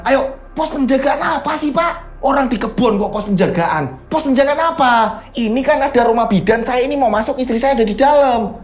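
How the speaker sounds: high, exasperated arguing; indignant protest. Acted. Driver refusing the guard post with wife inside, indignant protest.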